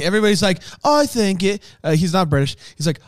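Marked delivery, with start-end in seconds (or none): none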